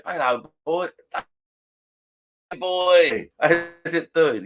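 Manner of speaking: loud, high, cheery pub greeting; hearty greeting. Retold or imitated. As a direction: greet him like an old mate. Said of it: "someone walks in and says".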